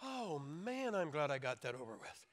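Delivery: hammed-up, quiet relief; mock relieved child. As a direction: play a kid relieved it's over. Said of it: "And they would just go like".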